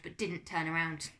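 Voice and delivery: ordinary delivery, nothing marked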